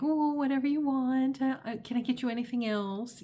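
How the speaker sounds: high, eager-to-please cooing; mock people-pleaser falling over themselves to serve; fawning. Acting out fawning at an upset person, high and ingratiating. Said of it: "so that would be fawning".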